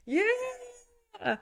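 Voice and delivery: very high, drawn-out squeak; acted giddy falsetto cheer; elated squeal. [name]'s elated reply to Cal.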